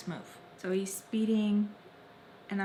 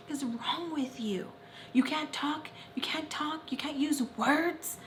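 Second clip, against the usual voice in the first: high-pitched, exasperated retold scolding; mock incredulous rant, pitched up; frustrated; 8 semitones higher than usual. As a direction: imitate someone berating a silent partner in frustration. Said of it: "he gets even more frustrated".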